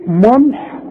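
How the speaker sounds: ملح said plainly; everyday word. Shown as a demonstration. Teacher uses ملح to show the thin م. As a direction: compare with ملح. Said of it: "تقول ايه".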